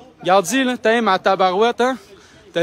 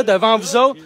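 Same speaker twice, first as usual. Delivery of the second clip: ordinary talk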